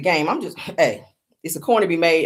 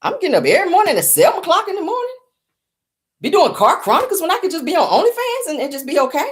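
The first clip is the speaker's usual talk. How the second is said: mock outrage; 9 semitones higher than usual. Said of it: "I said".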